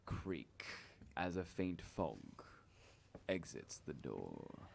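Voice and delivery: low, slow eerie scene-setting; slow, hushed storyteller voice; spooky narration. Acted. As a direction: draw them into the haunted hall.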